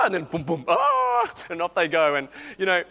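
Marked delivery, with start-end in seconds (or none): 0.7-1.3 very high, loud startled "oh"; surprised squeak. Retold or imitated. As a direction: yelp as the bike wobbles. Child wobbling, surprised.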